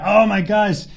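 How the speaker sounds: normal talking voice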